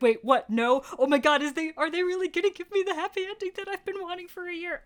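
loud, high gasping rush of hope; excited disbelief. Retold or imitated. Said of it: "and you're like".

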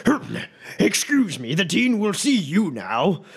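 a hint of a curt burly professor; gruff professor. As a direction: brush them off on the way out. Professor brushing past.

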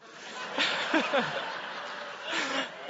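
mock evil laugh of the scary stranger; sinister laugh. Preacher mimics the stranger's creepy laugh, hammed-up.

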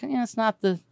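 ordinary delivery

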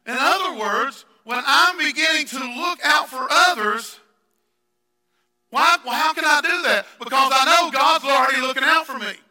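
loud, high, fervent preaching; preaching at full force. Acted. Pastor preaches to the congregation, full force. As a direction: press the point home, shouting.

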